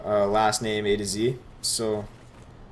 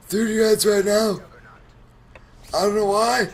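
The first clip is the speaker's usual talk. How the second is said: chronic stoner voice; 10 semitones higher, 4 dB louder than usual. Retold or imitated. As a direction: drag it out like a burnt-out stoner coach. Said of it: "Get ready for my chronic stoner voice".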